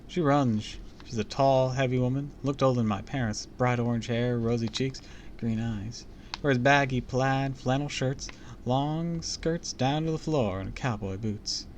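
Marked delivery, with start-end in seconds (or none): none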